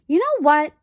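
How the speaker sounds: put-on eager girl exclaiming; sudden exclamation. A narrator's reading. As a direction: blurt it out suddenly. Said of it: "exclaimed Arrietty suddenly".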